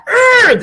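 loud high teasing roar of rage; ragey teasing growl; mock angry roar. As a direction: roar like a cartoon hothead to mock her. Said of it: "John used to tease me".

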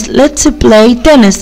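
"Let's play tennis" drawn out slowly and clearly; slow model reading. Shown as a demonstration. Teacher reading to young pupils, slow and clear. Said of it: "يلا بينا نلعب تنس".

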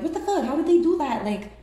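high, loud, incredulous voice of the others; baffled onlookers. Retold or imitated. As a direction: play the stunned crowd who can't believe it. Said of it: "everybody's curious about".

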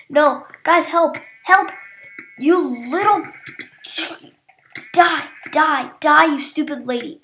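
loud panicked shouts; yelling for help. Real, not acted. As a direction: shout for help, then attack. Attacked in game.

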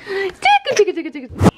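high squealy tickle chant; giggly tickle attack. Real, not acted. Tickling other toddler.

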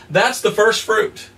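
usual speaking voice

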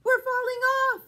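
squealing animals. Acted. Animals shriek as they fall off the horse. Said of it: "Squeal cat and dog".

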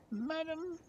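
put-on very high, soft girl's voice; devoted servant girl. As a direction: address your mistress in a tiny high voice. Servant girl addresses her mistress.

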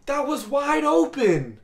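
high, loud hype yells; excited shouting. Real, not acted. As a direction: shout it out at a huge play. Great throw in the game.

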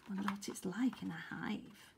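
soft wondering whisper. Real, not acted. Aside while reading.

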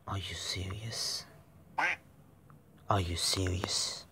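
whispered, stunned; disbelieving whisper. Real, not acted. Gamer whispering in disbelief at the game. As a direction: hiss the question in disbelief.

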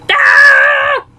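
short loud cry of dismay; dismayed shout. Acted. After Mario rips the card, a loud dismayed cry. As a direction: yell at the torn card.

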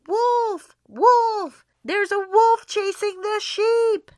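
loud, high cry of alarm; boy shouting a false alarm; shouted alarm. A narrator's reading. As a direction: yell wolf down the hillside. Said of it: "cupped his hands around his mouth and shouted".

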